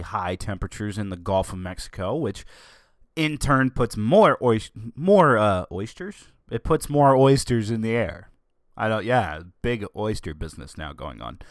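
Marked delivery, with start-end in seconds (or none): none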